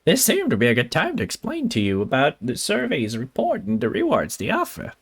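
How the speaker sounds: swooping cartoon professor voice; Doofenshmirtz-like professor. Streamer as professor explaining survey rewards, swooping cartoon voice. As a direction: explain it in a lilting mad-scientist voice. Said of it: "it kinda sounded like the witch voice".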